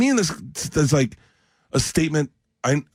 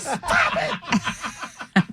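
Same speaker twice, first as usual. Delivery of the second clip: laughing protest; 4 dB quieter than usual. Retold or imitated. Wife telling off his friend.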